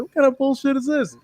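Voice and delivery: lightly voiced annoyed wrestler; imitating Willie. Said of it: "it sounded like".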